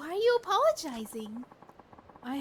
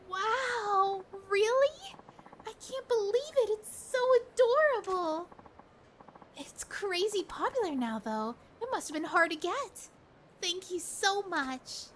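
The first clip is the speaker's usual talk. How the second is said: put-on high, delighted young woman gushing; thrilled girlfriend opening her present; 7 semitones higher than usual. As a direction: squeal over the gift in a higher voice. Voices girlfriend receiving gift.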